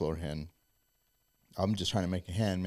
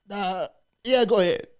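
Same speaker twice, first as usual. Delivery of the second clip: mock driller; 11 semitones higher, 7 dB louder than usual. Driller voiced in story.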